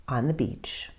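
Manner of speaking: usual speaking voice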